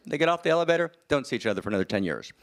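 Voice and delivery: ordinary delivery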